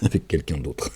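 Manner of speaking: normal talking voice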